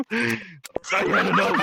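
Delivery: slow laughing declaration. Acted. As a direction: drag it out while laughing.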